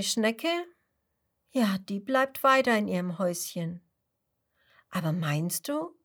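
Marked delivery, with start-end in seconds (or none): none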